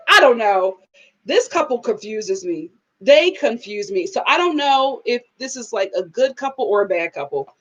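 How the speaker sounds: usual speaking voice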